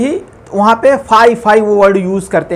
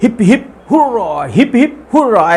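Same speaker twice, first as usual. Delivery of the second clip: imitated victory cheer. Teacher shows pupils how winners cheered at shield presentations, mild imitated cheer. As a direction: recall a crowd's cheer, without shouting it. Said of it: "तो जब उनको shield दी जाती थी तो बोलते थे".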